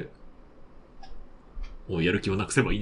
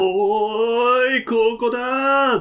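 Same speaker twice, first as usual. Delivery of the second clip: long drawn-out high call; calling from far away; 12 semitones higher, 5 dB louder, much slower than usual. Pretend. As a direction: shout it across a distance. Calling from far behind, playful.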